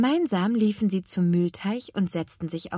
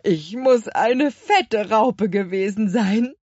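slow, louder moth character voice; clucking fat moth; 3 semitones higher, 6 dB louder, slower than usual. A narrator's reading. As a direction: cluck it out slowly as a plump moth. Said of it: "Gluckste die dicke Motte". (gemächlich glucksende dicke Motte)

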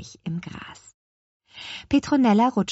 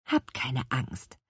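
slow, low, gentle reassurance; calming witch, voice dropped; reassuring; 4 semitones lower, 4 dB quieter, slower than usual. A narrator's reading. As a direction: soothe the children unhurriedly. Witch reassures the worried children.

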